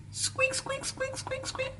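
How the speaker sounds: very high tiny squeaks; tiny mouse-like squeaks from a rusty engine; squeaky wheel noise. Acted.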